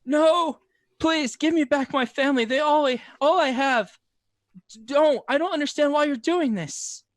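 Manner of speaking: high, loud desperate pleading; high broken sobbing plea. Acted. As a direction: beg through sobs for his family. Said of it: "sobbing and crying out".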